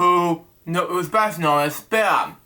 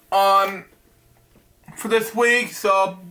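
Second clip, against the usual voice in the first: talking with a drink in mouth; mouth full; 3 semitones higher, slower than usual. Real, not acted. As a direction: speak around a mouthful of drink. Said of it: "I got Gatorade in my mouth".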